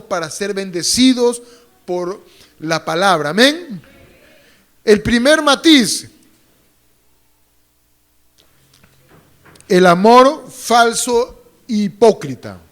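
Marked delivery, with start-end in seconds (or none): 4.8-12.6 loud, slow emphatic announcement; preacher's booming declaration; preaching at full force. Acted. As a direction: proclaim the point to the church. Preacher announcing point loudly.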